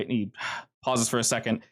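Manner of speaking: ordinary talk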